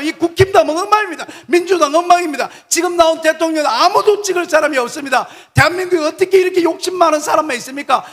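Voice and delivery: high, loud impassioned sermon; preaching at full force, lament for the nation; fiery preaching. Real, not acted. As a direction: rail at the nation from the pulpit.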